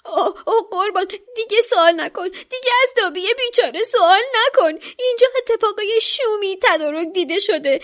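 a panicked elf begging him to stop asking; stammering elf. A narrator's reading. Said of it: "با تته پته گفت". (جن خانگی با صدای زیر، با تته پته و هراسان)

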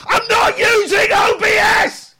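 angry shout. Real, not acted. Yelling at chat again.

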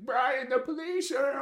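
high, slow mock shout up the stairs; grandma calling out. Grandma calling Brian about the police, high mock shout. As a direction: call out like a flustered grandma.

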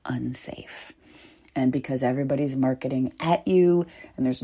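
a single breathy whispered word; whispering. Real, not acted. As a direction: let it fall to a whisper.

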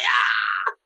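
high shouted gasp of joy; excited scream. Real, not acted. Teller reliving his own theater reaction, high shout. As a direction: scream it out thrilled. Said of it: "I was just like".

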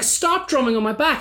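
high-pitched, fed-up order; exasperated demand. Retold or imitated. Gig-goer to the drummer behind him, exasperated. Retold quarrel escalating.

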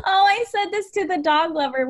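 high laughing apology; giggly realization. Real, not acted. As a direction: laugh out the line. Laughing at guest's emotion.

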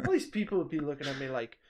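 ordinary talk

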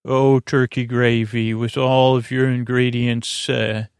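ordinary talk, nothing marked

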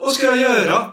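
high imitated plea; panicked. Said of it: "man hör ju liksom paniken i honom".

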